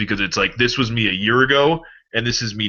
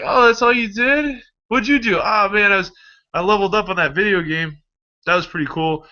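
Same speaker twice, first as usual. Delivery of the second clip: a hint of a slacker; 11 semitones higher than usual. Slacker voiced in a dialogue.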